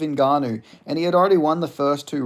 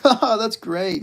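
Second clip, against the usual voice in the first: mocking Jon Jones voice; 9 semitones higher, 5 dB louder, much slower than usual. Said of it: "that little fucking fake voice he does".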